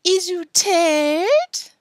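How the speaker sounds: put-on very slow, high, loud baby talk; mock-sympathetic little-girl falsetto; shrill, girlish voice. A narrator's reading. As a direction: draw out a girlish taunt. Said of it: "calling in a shrill, girlish voice".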